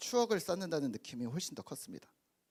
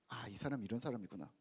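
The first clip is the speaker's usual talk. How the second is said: quiet realization; 7 semitones lower, 9 dB quieter than usual. Retold or imitated. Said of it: "라고 말하는 것보다".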